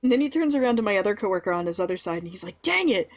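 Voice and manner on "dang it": high-pitched mock exasperation; mock frustration. Boss turns to other coworker, imitated frustration. Boss's frustration, acted in anecdote.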